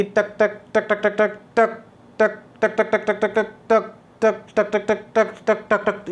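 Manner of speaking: tak tak with some long, some short, uneven gaps; Morse code tapping. Shown as a demonstration. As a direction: tap it out like a telegraph key. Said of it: "इस तरीके से आवाज़ आएगी". (Morse code जैसी कभी लंबी कभी छोटी टक टक)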